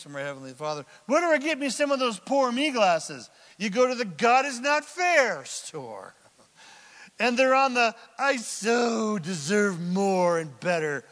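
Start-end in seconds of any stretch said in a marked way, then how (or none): 1.1-6.1 loud, higher comic repeat of the joke; preacher's loud comedic callback; louder replay. Acted. 8.2-11.0 slow, loud, hammed-up entitled moan; mock entitled cry; loud mock whine. Mock self-pitying shopper.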